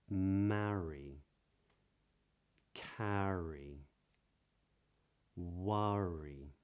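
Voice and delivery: each word spoken slowly with a curled British R; modern British accent. Shown as a demonstration. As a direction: model each R word as a clear drill. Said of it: "you hear it in words like these".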